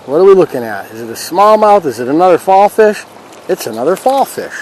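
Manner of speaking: ordinary delivery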